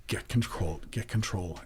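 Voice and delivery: quiet, choked self-talk to steady himself; real emotion held back. Real, not acted. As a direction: murmur it to yourself, fighting tears. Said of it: "I can feel it coming now".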